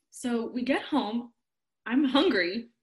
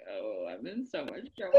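much lower, doomed groan-like voice; dreading voice; 12 semitones lower, 4 dB quieter than usual. Retold or imitated. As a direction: sink into guilty dread. Dread after seeing the gift.

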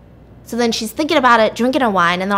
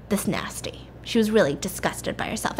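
softer, a whispered confidential remark; whispered aside; 6 dB quieter than usual. Real, not acted. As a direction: lean in and whisper the judgement. Whispered aside about the heroine.

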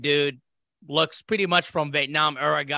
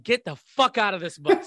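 higher-pitched mock angry order; retold angry order; 5 semitones higher than usual. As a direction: imitate a mad drill instructor. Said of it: "he was mad. Like, he was super mad".

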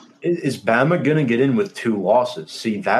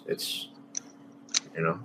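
ordinary talk, nothing marked

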